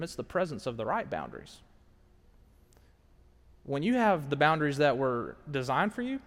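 ordinary talk, nothing marked

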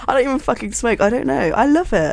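high, giggly confession. Real, not acted. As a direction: laugh it off brightly. Laughing about smoking area.

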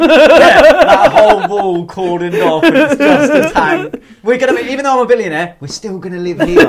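giddy, laughing at the dream; laughing fantasy. Pretend.

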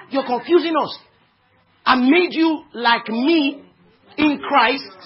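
high, loud preacher's shout; exasperated rebuke. Acted. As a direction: cry it out as heaven scolding a confused believer. Voicing heaven's exasperated rebuke, rising to shout.

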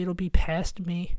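ordinary talk, nothing marked

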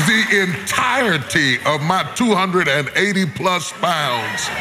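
a sermon climbing toward its climax; preaching. Acted. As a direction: preach it up to the peak.

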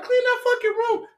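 loud, high mock scolding; imitated angry mother. Son mimics mama before the phone rings, high angry yell. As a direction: holler like a mother ordering a chore. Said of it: "She like".

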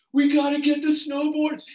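high, loud rallying call; acted urgent cry. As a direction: imitate friends in a frantic rush. Friends rushing back for boards.

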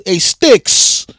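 high, loud hammed-up movie call. Quoting a movie line to a fellow fan, calling out. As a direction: holler it like a film catchphrase.

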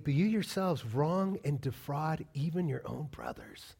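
slow, soft reading of the verse; scripture reading. Shown as a demonstration. Preacher reading verse eight to the church, slow and grave. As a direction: read the verse slowly and gravely. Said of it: "he says, verse eight".

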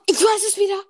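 high, fast sudden realization; excited. Real, not acted. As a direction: burst out with the answer. Sudden recall with a gasp.

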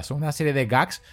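normal talking voice

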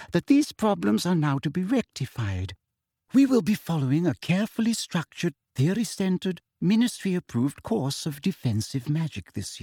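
high, prim ministry teacher; sugary teacher voice. Acted. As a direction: lecture sweetly like a prim official. Umbridge lecturing class.

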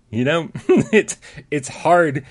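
breaking up with laughter mid-sentence; laughing while trying to say it. Real, not acted. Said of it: "is that distracting".

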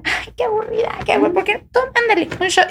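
high-pitched pestering friend; mock peer pressure. Friend pushing a shot at a party, mock pestering.

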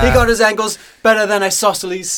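high, loud commentator impression; Ray Hudson. Podcast guy mimicking a favorite soccer commentator, excited impression. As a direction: crow it like an over-excited match commentator. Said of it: "that's, uh, Ray Hudson".

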